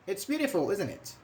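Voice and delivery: "It's beautiful, isn't it?" with the pitch falling; falling intonation. Shown as a demonstration. Teacher demonstrates a tag needing no answer, falling intonation. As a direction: let "isn't it" drop away at the end. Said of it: "This is falling".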